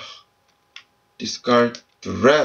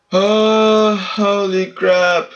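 excited shout; 8 semitones higher, much slower than usual. Real, not acted. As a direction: burst out at a great pull. Great pull revealed.

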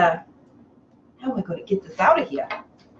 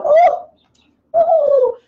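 high, loud "oh"; startled, high-pitched exclamation; shocked gasp-like oh; 14 semitones higher, 7 dB louder than usual. Real, not acted. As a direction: cry out at your own slip. Shocked at own swearing slip.

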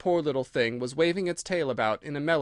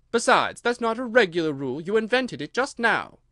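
high, raised-voice objection; indignant challenge to authority; defiant; 7 semitones higher, 4 dB louder than usual. Acted. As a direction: argue back indignantly. Alice argues with the king.

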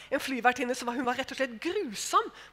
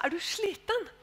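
kindly asking; 7 semitones higher than usual. Retold or imitated. Approaching the exhausted stewardess, smiling and kind. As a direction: ask gently with a smile. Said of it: "jeg var riktig så vennlig".

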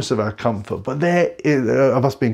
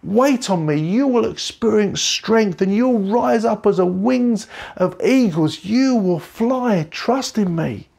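higher, slow, tender reassurance; God comforting; 7 semitones higher, slower than usual. Retold or imitated. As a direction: speak as God soothing a hurting child. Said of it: "he says".

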